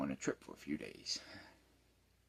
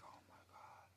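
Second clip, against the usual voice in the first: whispered dismay; 16 dB quieter than usual. Retold or imitated. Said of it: "I was like".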